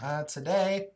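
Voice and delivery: usual speaking voice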